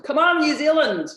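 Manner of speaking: put-on loud, high New Zealand accent cheer; rally the Kiwis in a big New Zealand accent. Speaker cheering on New Zealand viewers, loud and high in a New Zealand accent. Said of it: "That's my New Zealand accent".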